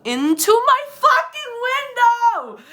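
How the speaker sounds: high, loud, slow shout; panicked shout. Retold or imitated. Woman retelling a stranger reaching into her car, panicked shout. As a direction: build to a high, drawn-out yell of outrage. Climax of frightening story retold.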